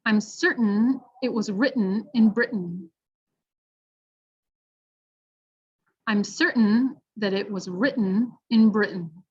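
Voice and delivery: "shorten" and "curtain" with throat-stopped T; careful teaching read; glottal T sentence. Shown as a demonstration. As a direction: model sentence with swallowed T. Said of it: "Number three".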